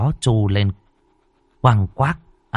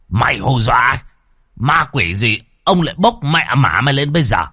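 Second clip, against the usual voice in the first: blustering threat; 5 semitones higher, 3 dB louder than usual. Acted. Old man threatens the ghost.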